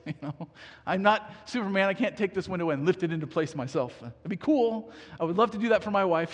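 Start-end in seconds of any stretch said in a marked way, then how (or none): none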